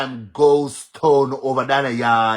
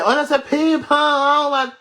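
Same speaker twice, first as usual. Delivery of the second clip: mock whine; 10 semitones higher, 4 dB louder than usual. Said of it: "they want me to say".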